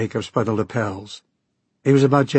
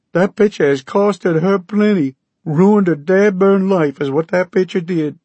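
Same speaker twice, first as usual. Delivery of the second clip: higher, louder Okie twang, aggrieved; grieving Okie farmer; 9 semitones higher, 5 dB louder than usual. Acted. Okie farmer voiced in story.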